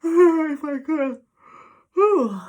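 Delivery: mid-yawn, high and loud. Real, not acted. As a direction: yawn the words out. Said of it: "apologize for the yawning".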